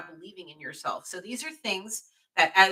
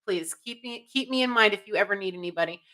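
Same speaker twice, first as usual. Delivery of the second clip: ordinary talk, nothing marked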